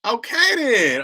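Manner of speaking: high surprised okay; delighted it actually worked; bright rising relief; pleased surprise. Real, not acted. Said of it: "So it worked".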